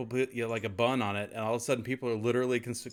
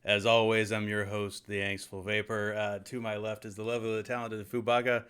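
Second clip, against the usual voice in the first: show host; 3 semitones lower, 3 dB quieter than usual. Pretend. Host introduces show hosts.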